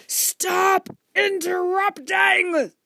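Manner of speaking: high, slow, booming-loud command; furious shout. A narrator's reading. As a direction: stretch each word in a full shout. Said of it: "Mister Franklin shouted".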